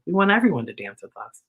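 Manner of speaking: plain reading voice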